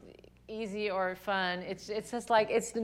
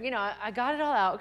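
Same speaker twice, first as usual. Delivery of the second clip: ordinary talk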